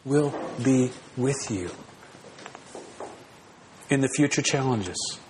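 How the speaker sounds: pastor voicing God's quiet promise to stay near; tender promise. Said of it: "God personally comes to us in Christ and says".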